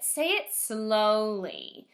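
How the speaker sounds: slow, drawn-out say it slowly. Shown as a demonstration. Teacher to first graders, showing slow speech. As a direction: model slow speech as you say it. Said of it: "And don't just say it".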